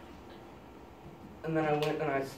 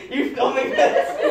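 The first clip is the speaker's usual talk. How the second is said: slow, louder and higher through laughter; laughing; 12 semitones higher, 8 dB louder, slower than usual. Real, not acted. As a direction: ask it while cracking up, high. Laughs at being filmed.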